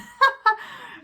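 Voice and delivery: short, high real laugh; brief burst of high laughter. Real, not acted. Vlogger admits seeing all the actor's movies, laughing.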